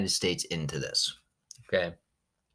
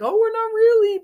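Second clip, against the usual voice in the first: high squeaky fake-innocent voice, much louder; mock innocent; 8 dB louder than usual. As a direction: play coy like a denying kid. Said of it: "they just wanted to make it seem like".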